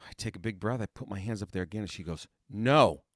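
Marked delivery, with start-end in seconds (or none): 2.5-3.0 one loud, firm mock refusal; curt rejection. Teacher stopping student's second sight-reading try, loud and firm. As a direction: cut the student off loudly.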